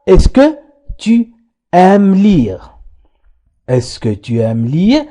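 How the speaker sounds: very slow, syllable by syllable; slow teacher demo; slow model sentence. Shown as a demonstration. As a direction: say it slowly so they can repeat. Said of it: "حاولوا أن تنطقوها".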